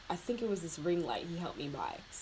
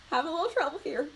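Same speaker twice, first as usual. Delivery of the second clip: laughing; 8 semitones higher, faster than usual. Real, not acted. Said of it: "trying to talk and do smoky eye".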